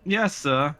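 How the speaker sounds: high, loud, fake-accented little boy; dutiful son, pitched high and loud; put-on child accent. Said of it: "might wanna drop the accent".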